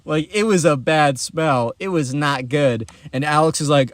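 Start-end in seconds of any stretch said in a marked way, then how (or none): none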